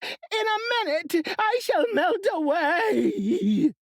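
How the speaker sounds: high, slow wailing scream; witch screaming. A narrator's reading. Melting witch screaming at Dorothy, high and slow. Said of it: "she screamed".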